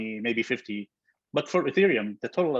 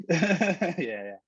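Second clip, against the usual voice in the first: laughing yeah; 6 semitones higher than usual. Real, not acted. Laughs at crypto question.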